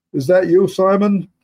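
a different soldier's voice calling out. Acted. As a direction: shout a greeting to a comrade. Another man calls out.

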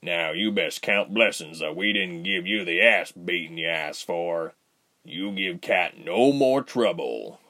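slow, low, rumbling threat; big bear of a man laying down the law. A narrator's reading. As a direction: rumble a warning, taking your time. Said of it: "Bear said in a low, rumbling voice".

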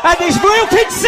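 huge high goal yell; goal shout. Real, not acted. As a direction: scream the goal call. Goal call in live commentary.

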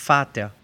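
"Vater" with an f sound, "fahta"; German "Vater" starting on f; V como F. Shown as a demonstration. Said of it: "la misma que la F en alemán". ("Vater" pronunciado "fáta")

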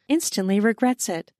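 usual speaking voice

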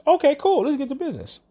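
chirpy agent reply. Retold or imitated. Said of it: "agent's cheerful reply".

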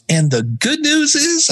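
high, slow teasing question; playful question. Retold or imitated. Friend on the phone asks for the good news, high and teasing. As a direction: draws it out, high and amused.

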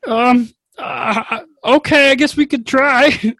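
put-on high, small worried kid voice; a nervous child begging to go inside; timid child voice. Same child character hesitantly agrees.

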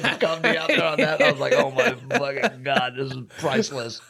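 quoting himself through laughter; half-laughing the words out. Retold or imitated. Cracking up retelling funny scene.

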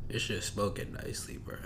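breathy stoned whisper. Real, not acted. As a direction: let it drift out under the breath. Stoned muttering.

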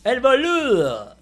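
loud, high, drawn-out ring-announcer call; announcer introduction. Real, not acted. Host bringing on his co-host from Argentina, big announcer call. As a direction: stretch the words out and belt them.

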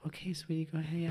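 a hint of a placating mother; indulgent mom. Mom letting her little girl into the purse, lightly voiced indulgence.

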